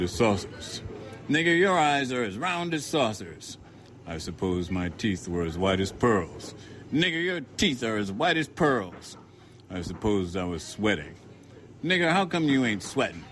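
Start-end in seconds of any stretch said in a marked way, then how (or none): 1.3-3.6 mocking bigot. A narrator's reading. Narrator voices the mocking man. 6.9-9.2 mocking bigot. A narrator's reading. Narrator voices the mocking man. 11.8-13.2 mocking bigot. A narrator's reading. Narrator voices the mocking man.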